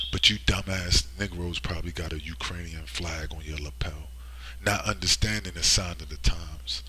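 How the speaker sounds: unvoiced whispered aside; hushed scornful whisper. Real, not acted. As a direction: lean in and whisper it.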